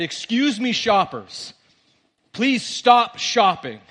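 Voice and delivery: imitated urgent store announcement; public announcement. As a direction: address the whole store, slowing on the plea. Acts father's announcement to whole store.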